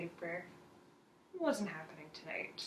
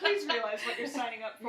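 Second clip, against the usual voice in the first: higher and louder, amused; laughing warning; 4 semitones higher, 6 dB louder than usual. Real, not acted. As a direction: warn them through your laughter. Jokingly warning friends amid laughter.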